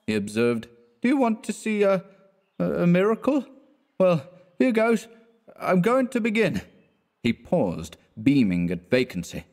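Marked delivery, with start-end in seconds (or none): 1.0-6.6 put-on much higher, grand drunken announcement; a drunk showing off a trick; tipsy showman. A narrator's reading. Drunk Clifford boasts to his friend, higher and tipsy.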